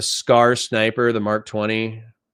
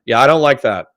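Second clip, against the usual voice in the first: normal talking voice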